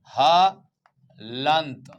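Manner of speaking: "हलंत" drawn right out in two parts; slow syllable-by-syllable. Shown as a demonstration. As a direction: stretch "हलंत" very slowly, splitting ह from लंत. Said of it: "ध्यान रखना". ("हलंत" को तोड़कर, बहुत धीरे बोलें)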